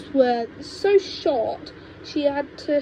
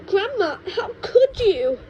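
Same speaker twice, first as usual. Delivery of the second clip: high, loud horrified cry; appalled boy; 9 semitones higher, 6 dB louder than usual. Acted. George appalled at grandma, high horrified cry.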